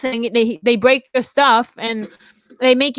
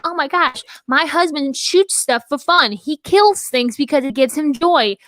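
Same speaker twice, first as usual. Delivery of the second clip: put-on Southern US accent; 3 semitones higher than usual. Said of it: "I don't know why I got so Southern".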